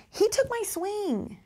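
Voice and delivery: put-on slow, high whiny child voice; complaining child. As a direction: mimic a kid telling on a friend. Teacher voicing complaining child.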